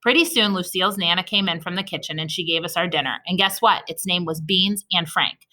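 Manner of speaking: ordinary delivery, nothing marked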